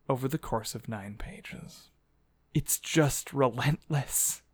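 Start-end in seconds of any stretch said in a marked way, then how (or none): 0.1-1.9 hushed weary whisper. Real, not acted. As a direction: whisper it in despair. Whispered aside.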